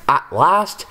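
ordinary delivery, nothing marked

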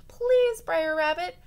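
high, loud desperate begging; pleading. Acted. Bear begging the rabbit to tie him up, desperate. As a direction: beg for help, scared. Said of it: "he pleaded".

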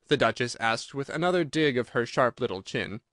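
usual speaking voice

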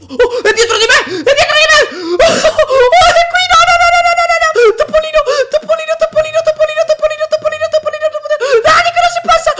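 panicked high screaming; being chased. Real, not acted. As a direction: shriek in terror, laughing. Mouse chasing him, screaming. (inseguito, urla in panico ridendo)

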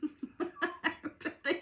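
high, breaking laugh; real fit of laughter. Real, not acted. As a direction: burst into high giggles. Laughs at Ted mid-reading.

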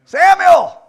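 booming, high summons; calling out. Acted. God calling Samuel by name, loud call. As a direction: call the name loudly from afar.

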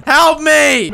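loud, high cry for help; man down shout. Real, not acted. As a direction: yell for help. Downed, crying for help.

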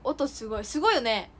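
ordinary talk, nothing marked

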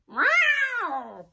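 high loud grumpy meow; cat meow. Acted. Cat Tiddles answers.

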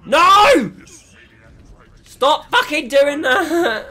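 high, loud and slow, near a cry; enraged scream. Real, not acted. Gamer being knocked off again, enraged scream. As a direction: scream the protest at the game. Enemy keeps knocking him off.

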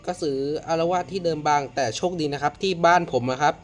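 ordinary talk

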